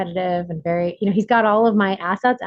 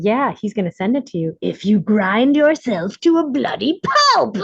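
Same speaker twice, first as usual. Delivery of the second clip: trauma voice. Retold or imitated. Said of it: "my trauma software gets really tricky and starts saying".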